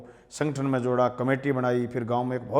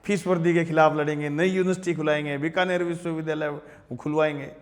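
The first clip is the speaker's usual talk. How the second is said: raised-pitch rally oratory; retold speech-making in insistent, repeated beats; imitated student speech; 5 semitones higher, 3 dB louder than usual. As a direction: reel off pledges like a young campus leader addressing a crowd. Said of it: "तो मैं खड़ा होके".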